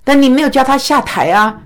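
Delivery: normal talking voice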